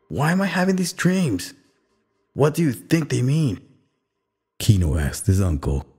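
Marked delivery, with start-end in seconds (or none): 0.1-3.6 put-on high, anxious young boy pleading; worried boy asking fast for answers. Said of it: "Kino's blood was pumping".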